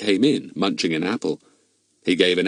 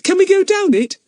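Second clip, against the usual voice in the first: put-on excited girl; 7 dB louder than usual. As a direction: breathe it out with wide-eyed thrill. Betts asks eagerly.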